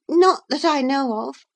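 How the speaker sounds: hesitant, tripping over the words; a caught-out girl stammering; high, faltering girl's voice. A narrator's reading. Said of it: "stammered Miss".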